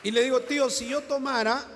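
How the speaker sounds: a preacher raising his voice at the climax of his story; loud preaching. Real, not acted. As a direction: drive the point home out loud. Preacher retelling, rising loud.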